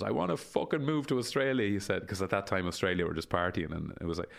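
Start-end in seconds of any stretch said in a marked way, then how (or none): 0.0-1.7 higher, strained kid's outburst, imitated; frustrated nephew. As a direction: mimic a fed-up boy swearing in protest. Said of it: "he just goes".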